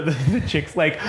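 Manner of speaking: usual speaking voice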